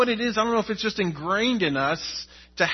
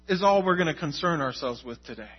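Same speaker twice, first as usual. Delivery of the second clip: ordinary talk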